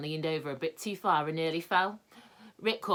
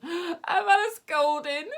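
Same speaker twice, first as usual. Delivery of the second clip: slow, much higher weeping voice; 13 semitones higher, 8 dB louder, slower than usual. A narrator's reading. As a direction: sob out the complaint. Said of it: "wept Moonface".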